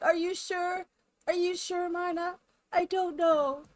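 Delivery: high, anxious, doubting whine; freaking out. Pretend. Pestering Mina for reassurance, freaking out. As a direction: fret as if nothing could calm you. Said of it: "like, freaking out".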